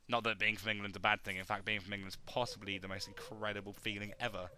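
ordinary delivery

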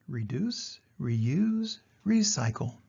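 ordinary delivery

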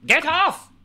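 shrill barked German officer interrogation; Gestapo impression. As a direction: bark it like a movie Nazi officer. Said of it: "my Gestapo impression".

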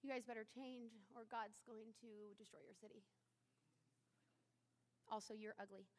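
low, soft, sullen muttering; grumpy mutter. Retold or imitated. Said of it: "it probably looked something like this".

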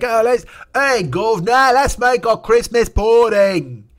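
slow, loud, high old English toff with bad teeth; mock old English voice. Mocking English settlers before sailing, slow English toff. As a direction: ham up an old English gent, drawn out. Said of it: "I don't know who's doing that voice".

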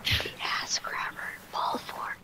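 hushed mock-secret whisper. Whispered announcement while gaming.

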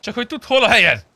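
sudden high, loud shout to show who is boss; mock power shout. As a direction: blast it out like a playful threat. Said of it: "Ez mi volt?".

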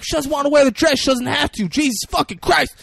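high, heated acted retort; exasperated anger. Intruder defending her in the quarrel, exasperated anger.

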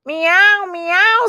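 high, perky meows; meowing rabbit. A narrator's reading. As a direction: mew like a cat. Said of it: "said the rabbit".

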